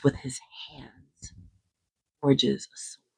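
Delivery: hushed whisper for drama; storyteller's whisper over the forging. Acted. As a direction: breathe the words like a secret.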